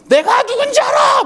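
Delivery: very high, hammed-up angry customer yell; mock furious shout. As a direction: bellow like an outraged customer banging his bowl. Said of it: "저렇게 큰 소리를 치냐". (화난 손님 흉내로 고래고래 소리치듯)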